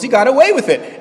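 high, loud burst of disbelief; outraged exclamation. Retold or imitated. Courtroom reacting to guilty man freed, loud exclamation.

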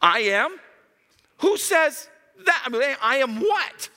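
baffled listener. Retold or imitated. Mocking confused listener.

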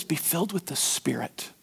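soft hushed whisper of scripture; hushed whisper quoting Paul. Acted. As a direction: whisper the verse reverently. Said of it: "Paul says".

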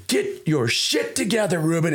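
dad's warning. Retold or imitated. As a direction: drag it out like a fed-up dad. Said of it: "he would say it just like that".

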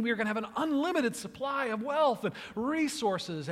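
high, loud, giddy building celebration; jubilant king. Retold or imitated. Midas celebrating his golden touch, high and jubilant. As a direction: crow like a king who just won everything. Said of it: "as he is celebrating and jubilant and excited".

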